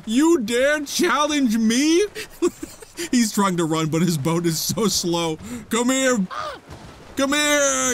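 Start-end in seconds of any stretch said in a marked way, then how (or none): 0.0-2.0 slow, loud, much higher hammed-up villain; mock villain. Piloting his monster at the fleeing enemy, hammed-up villain. 5.7-7.9 loud, higher hunting shout; mock menacing call. Chasing the enemy boat, loud playful shout.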